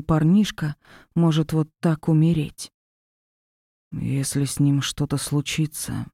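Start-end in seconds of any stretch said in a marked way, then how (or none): 3.9-6.1 low, slow, hollow and menacing; hushed creeping horror; hollow eerie voice. Acted. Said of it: "глухим жутким голосом".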